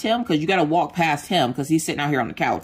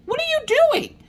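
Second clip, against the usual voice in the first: much higher, indignant shriek; shocked outburst. Retold or imitated. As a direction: protest in disbelief as he takes your kid. Husband drags daughter away.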